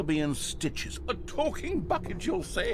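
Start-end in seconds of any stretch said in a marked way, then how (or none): 1.1-2.7 very high mocking sarcasm; sarcastic. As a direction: scoff in a very high voice. Sarcastic narrator mockery.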